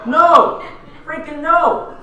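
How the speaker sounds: high, loud, insistent no; emphatic shout. Real, not acted. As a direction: shout the answer back at the crowd. Answering the audience's weak no.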